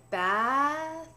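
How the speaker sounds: very slow drawn-out "bath" with tongue out; slow over enunciating. Shown as a demonstration. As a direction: stretch "bath" slowly, tongue between teeth. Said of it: "when I say it slowly".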